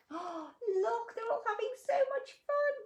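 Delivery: high, loud, gushing delight. Real, not acted. Storyteller delighting in the monsters' games, gushing. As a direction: gasp and gush with joy.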